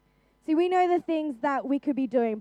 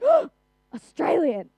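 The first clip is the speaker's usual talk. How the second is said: imitated American amazement; 4 semitones higher, 5 dB louder, slower than usual. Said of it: "And they're like".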